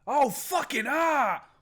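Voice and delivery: scream of pain. Acted. Man with a shattered ankle screaming in pain. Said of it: "The man screamed in pain".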